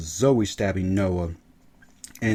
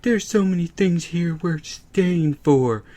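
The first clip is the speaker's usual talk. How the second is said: mock whiny teen; whiny Eli; 9 semitones higher, slower than usual. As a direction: whine to mom like a kid. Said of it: "He's like".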